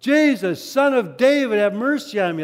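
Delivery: loud, high, insistent plea. Acted. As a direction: shout it across a crowd. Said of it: "All the louder he calls out".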